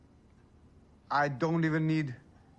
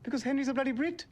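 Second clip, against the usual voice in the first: high-pitched exasperated outburst; 11 semitones higher than usual. Acted. Deliveryman answers yet another "Why?", exasperated. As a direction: blurt it out, pushed too far.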